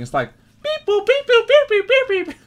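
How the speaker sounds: high beeping sounds; robot beeps. Shown as a demonstration. Gamer mimics his thoughts as robot beeps. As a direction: beep like a little machine. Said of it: "how my head is going".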